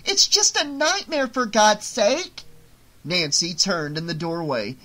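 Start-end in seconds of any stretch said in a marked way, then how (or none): 0.1-2.4 very high, loud, slowing plea; tearful pleading shout. Acted. Marge pleading with her leaving daughter, tearful shout. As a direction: beg her at full voice, desperate.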